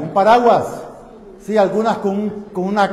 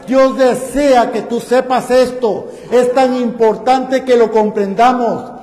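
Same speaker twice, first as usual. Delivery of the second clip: rising, louder pulpit exhortation, stretching key phrases slowly; fervent preaching; 3 semitones higher, 5 dB louder than usual. Acted. Preacher urging the congregation to seek God daily, fervent preaching. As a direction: drive it home to the congregation.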